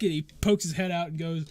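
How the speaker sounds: usual speaking voice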